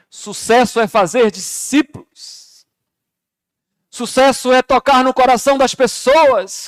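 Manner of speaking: loud, high impassioned preaching. Acted. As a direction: preach it with full force. Preaching at full force.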